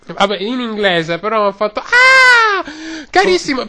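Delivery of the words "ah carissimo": high, whiny squeal; shrill, crying-edged squeak. Retold or imitated. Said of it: "Con questa voce stridula".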